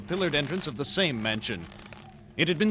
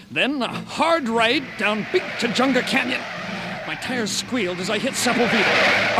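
loud, breathless chase narration; excited narration; 7 semitones higher, 7 dB louder than usual. Acted. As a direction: call the turns like a race. Narrating frantic car chase.

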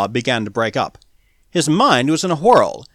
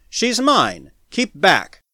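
raised, higher warning shout; defiant yell; 5 semitones higher, much slower than usual. A narrator's reading. Smith claiming the girl before the mob, defiant yell. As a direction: yell your claim and warn them off. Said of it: "he yelled".

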